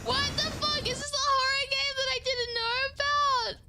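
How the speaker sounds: high, loud startled cry; horror-game jump-scare cry. Real, not acted. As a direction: yelp it out in real shock.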